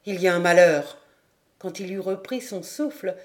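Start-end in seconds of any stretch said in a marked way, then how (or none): 0.0-1.0 raised voice breaking bad news; abrupt announcement. A narrator's reading. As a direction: burst in with urgent news. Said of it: "entra brusquement et annonça".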